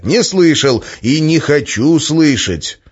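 loud, slow, emphatic refusal; irritated. A narrator's reading. Man refuses to hear the prophecy, irritated. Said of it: "раздражённо ответил я".

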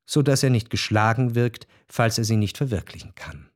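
usual speaking voice

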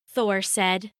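usual speaking voice

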